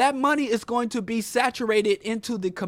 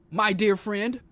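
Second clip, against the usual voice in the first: usual speaking voice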